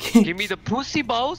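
squeaky high imitation; high mock voice. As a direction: pitch it way up, mocking. Quoting in-game voice line again.

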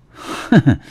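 short, scornful chuckle, pitched high; angry laugh. A narrator's reading. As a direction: scoff with an angry little laugh. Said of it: "唐庆怒笑道".

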